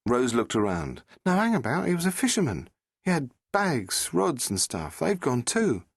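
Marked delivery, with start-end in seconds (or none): 1.2-5.8 put-on higher, lively young woman; Rose's voice. Rose asking the Doctor about the sea, a young woman's voice.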